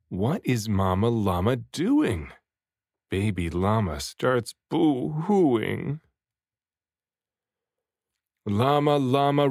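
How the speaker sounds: usual speaking voice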